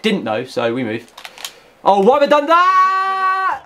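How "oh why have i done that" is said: high, slow, loud outcry; high drawn-out disbelief; baffled cry. Real, not acted. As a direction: wail at your own mistake. Confused by own misplay.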